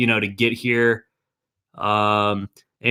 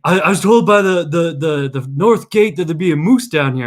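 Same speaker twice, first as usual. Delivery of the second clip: sweaty tourist; 6 semitones higher, 4 dB louder than usual. Retold or imitated. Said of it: "He's like".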